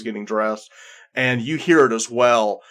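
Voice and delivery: ordinary talk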